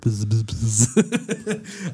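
drawn-out mock buzzing, very slow; slow buzzing hum. Shown as a demonstration. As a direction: drone it slowly into the ear. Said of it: "I talk very softly like this".

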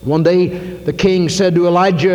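usual speaking voice

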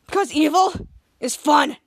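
slow, loud villain delivery; mock villain. Retelling Evil Henry's boast, slow mock villain. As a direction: relish each word like a pretend villain. Retold villain's gleeful line.

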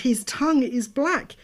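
ordinary delivery

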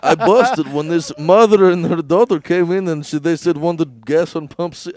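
slowed, put-on Indian accent. Co-host answers as gas station clerk, Indian accent. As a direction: tell it slowly in a comic Indian accent. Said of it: "Indian guy".

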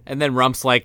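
ordinary talk

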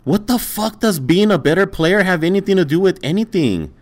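high-pitched, incredulous outburst; real exasperated protest; exasperated retort. Real, not acted. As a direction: argue back in disbelief, pitch way up. Exasperated retort in heated argument.

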